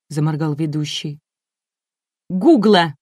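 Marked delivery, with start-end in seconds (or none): 2.3-2.9 громкий сердитый окрик; выкрикнуть, будто топая ногой; гневно. A narrator's reading. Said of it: "топнула Энн". (громко, с раздражением)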